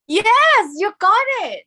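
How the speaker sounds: high, loud, delighted approval; thrilled teacher's confirmation; excited praise. Real, not acted. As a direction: cheer the student on with high pitch. Teacher cheering students' successful attempt.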